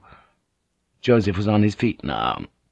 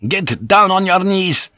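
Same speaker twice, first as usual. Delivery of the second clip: slow, high, forceful command; blazing zeal; 9 semitones higher, 4 dB louder than usual. A narrator's reading. Zealous superior ordering a rebel to kneel, blazing. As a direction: order it with righteous fury. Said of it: "his zeal blazing".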